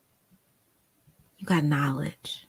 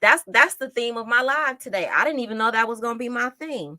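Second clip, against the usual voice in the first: usual speaking voice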